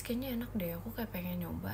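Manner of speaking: whispered retold thought; hushed imitation of herself. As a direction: whisper the temptation. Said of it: "terus habis itu kayak".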